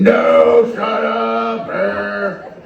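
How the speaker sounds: high, drawn-out imitated holler; mock heckler yell. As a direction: mimic a loudmouth yelling across the room. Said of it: "is that the same guy yelling over here".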